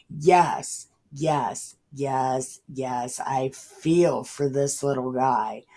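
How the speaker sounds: ordinary talk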